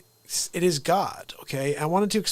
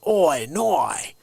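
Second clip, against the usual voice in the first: mock broad Australian accent, a bit louder; Steve Irwin Australian accent; 4 dB louder than usual. Host imitating Steve Irwin's Australian accent for a viewer. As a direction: tease the Australian accent with "Oi, noi". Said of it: "he should be like".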